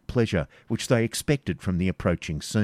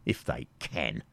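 low, slow, sneering chuckle; mocking laugh; 8 semitones lower, 9 dB quieter than usual. The knight mocking Isaac's forefathers with a laugh. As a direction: laugh it off cruelly.

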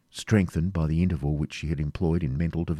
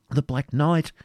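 ordinary delivery, nothing marked

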